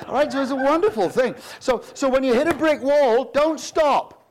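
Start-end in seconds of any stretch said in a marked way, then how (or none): none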